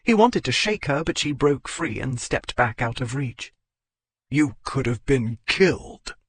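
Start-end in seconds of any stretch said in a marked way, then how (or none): 4.3-6.2 growled. A narrator's reading. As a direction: growl it at her, shaken. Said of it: "he growled".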